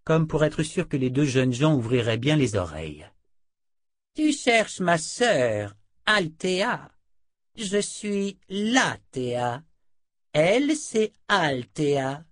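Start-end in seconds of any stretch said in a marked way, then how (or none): 4.1-12.2 slow, theatrically spaced-out reveal. A narrator's reading. Sorceress makes sure both young people listen, theatrical. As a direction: draw out each name for effect. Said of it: "Latea marqua une pause théâtrale".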